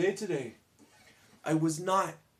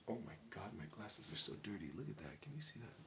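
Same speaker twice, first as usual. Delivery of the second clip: whispered aside; 8 semitones lower, 17 dB quieter, much faster than usual. Real, not acted. Noticing dirty glasses mid-talk.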